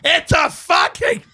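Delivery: high, drawn-out mock scream; imitated scream. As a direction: mimic screaming at a secretary, voice straining upward. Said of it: "scream at the secretary".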